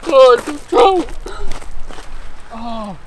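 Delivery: high, loud, thrilled yelling at the catch; excited shouting. Real, not acted. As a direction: shout with pure joy, fish on the line. Hooks and lands a trout.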